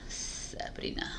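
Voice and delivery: whispered self-scolding; whispering. Real, not acted. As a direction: hiss your own name softly.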